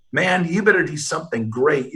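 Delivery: normal talking voice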